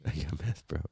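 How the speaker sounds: deep, very soft disguised voice; low put-on voice. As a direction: drop it low and quiet. Same line again, dropped low.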